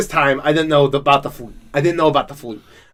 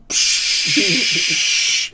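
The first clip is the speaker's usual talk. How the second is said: imitated extinguisher spray. Man retelling how he put out the fire, imitated spray hiss. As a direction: make a sustained "pshhh" like an extinguisher.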